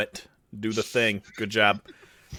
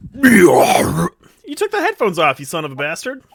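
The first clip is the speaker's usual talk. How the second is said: slow, louder, higher growly monster; mock monster voice for a child; 4 semitones higher, 8 dB louder than usual. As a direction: roar it at the kid like a monster. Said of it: "hear a monster voice".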